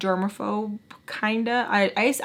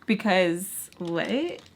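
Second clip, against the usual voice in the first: usual speaking voice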